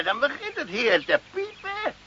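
ordinary delivery

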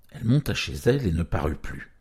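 plain reading voice

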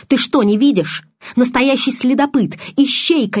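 взвинченно, выше обычного; убеждай, повышая голос; срываясь на крик. A narrator's reading. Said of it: "чтобы он срывался на крик". (на повышенных тонах)

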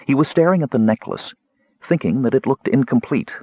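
ordinary delivery

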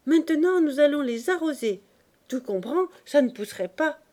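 put-on high, loud exclamation from an eager boy; excited cry. A narrator's reading. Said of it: "s'écriant".

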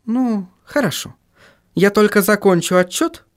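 high-pitched female character voice; giving in, in a high voice; voiced woman character. A narrator's reading.

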